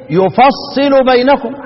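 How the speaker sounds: slow يفصل بينكم as "yufassilu baynakum"; يفصل said "yu-fas-si-lu"; doubled sad with i. Shown as a demonstration. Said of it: "حمزة والكساء يخلصوا كده".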